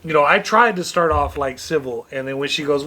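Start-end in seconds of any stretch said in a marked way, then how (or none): none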